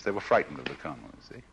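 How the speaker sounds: ordinary talk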